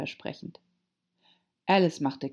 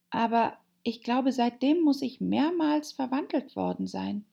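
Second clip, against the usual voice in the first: put-on high-pitched, timid girl character; hesitant, polite little Alice voice; shy; 8 semitones higher than usual. A narrator's reading. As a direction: answer shyly in a high voice. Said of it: "Alice erwiderte recht schüchtern".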